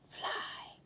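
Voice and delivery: hushed whisper. A narrator's reading. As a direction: breathe it right into his ear. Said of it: "whispered Timothy".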